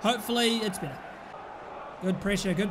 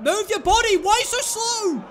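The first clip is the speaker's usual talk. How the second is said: impatient yelling; 9 semitones higher, 6 dB louder than usual. Real, not acted. Yelling at slow player.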